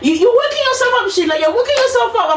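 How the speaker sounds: high, mocking impatient nurse. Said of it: "nurse dismisses her again".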